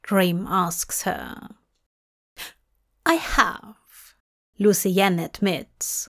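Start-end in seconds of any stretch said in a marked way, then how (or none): 3.0-3.8 smug. Acted. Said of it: "almost smugly".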